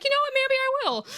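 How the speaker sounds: very high, huffy retort; mock defiance. Girl retorts to jealous friend, imitated defiance. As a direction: throw it back in a squeaky huff.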